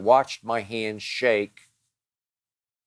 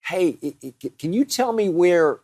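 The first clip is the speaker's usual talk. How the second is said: high, halting breathless asking; mock stammering jogger; 9 semitones higher, 3 dB louder than usual. Runner mimicking a stranger asking directions mid-run, high and stammering. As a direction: imitate a jogger stumbling over a question. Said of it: "to pull up and say".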